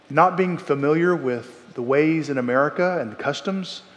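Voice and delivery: ordinary talk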